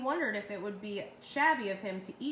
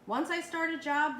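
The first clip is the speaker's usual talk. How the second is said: higher, louder firm declaration; firmly; 5 semitones higher, 4 dB louder than usual. A narrator's reading. Dentist insists to his wife, firm and raised. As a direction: state it with resolve. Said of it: "said the dentist firmly".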